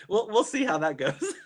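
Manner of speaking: high-pitched, giggly words; laughing. Real, not acted. Doubting her French, laughing as she talks. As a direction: speak through the laughter.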